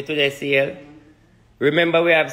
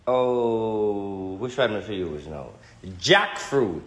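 lower, slow mystic teaching voice; mock spiritual lesson; 6 semitones lower, slower than usual. Schoolroom class on obeah for the viewers, lower, slow mystic voice.

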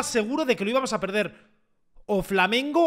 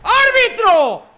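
stretched, high angry shout at the referee; furious yell; 11 semitones higher, 7 dB louder, much slower than usual. Real, not acted. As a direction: bellow it out in outrage. Furious at a penalty call.

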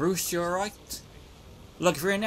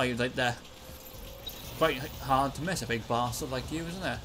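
normal talking voice

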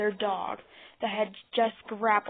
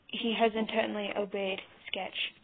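plain reading voice

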